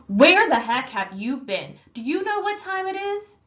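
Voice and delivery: loud furious demand, pitch jumping high; older brother furious at a kid out past two; angry. Acted. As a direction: tear into him for coming home so late. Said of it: "He was madder than I'd seen him".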